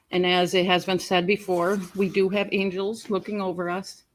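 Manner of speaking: ordinary delivery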